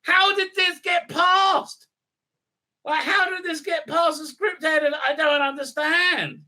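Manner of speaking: angry rant. Real, not acted. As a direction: rant at the show's writers. Ranting at the show.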